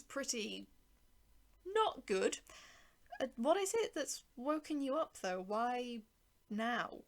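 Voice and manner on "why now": ordinary delivery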